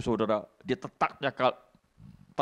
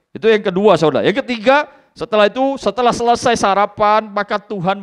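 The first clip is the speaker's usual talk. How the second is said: preaching at full force; 10 semitones higher, 12 dB louder than usual. Acted. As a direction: stir the congregation. Loud preaching. (khotbah keras dan bersemangat)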